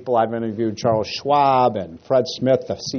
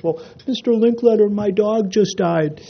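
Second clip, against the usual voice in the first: put-on high, small child's voice, slow and sad; little boy voice; 8 semitones higher than usual. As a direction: speak like a heartbroken six-year-old. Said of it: "the little boy says".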